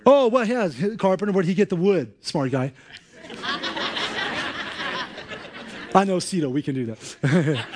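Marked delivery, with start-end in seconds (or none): 3.3-5.9 a burst of high, airy laughter; real laugh. Real, not acted. Laughing at own joke. 5.9-7.7 words spoken through laughter; laughing. Real, not acted.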